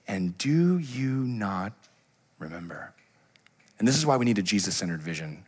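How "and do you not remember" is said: very slow, fading to near silence; hushed slow question. Retold or imitated. Jesus asking his disciples, slow and fading. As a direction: trail off into a hush. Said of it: "he goes".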